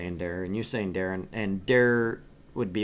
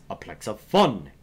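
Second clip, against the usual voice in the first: savoring the phrase; 5 dB louder than usual. Acted. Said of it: "I just like saying that".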